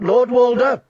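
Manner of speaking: high loud call; shouting again. Acted. Catelyn shouting to Lord Walder a second time. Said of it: "she shouted".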